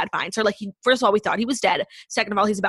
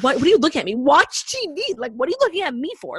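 higher, irritated mock scold at husband; annoyed mock snap; 6 semitones higher than usual. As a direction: play the exasperated wife, half joking. Acted snapping at husband watching her.

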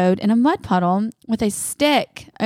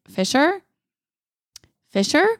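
higher calling voice, sweetly searching the house; calling nicely; 7 semitones higher than usual. Retold or imitated. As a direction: call out sweetly for the child. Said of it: "asking him nicely".

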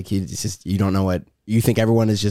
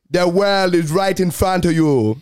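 slow, existential Jamaican musing; Jamaican accent; 3 semitones higher, slower than usual. Shown as a demonstration. Showing how Jamaican gets existential, slow Jamaican accent. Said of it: "Jamaican normally ends up being very existential".

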